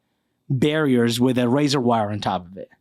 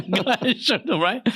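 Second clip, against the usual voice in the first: laughing; 5 semitones higher, faster than usual. Retold or imitated. Laughing buddy quote.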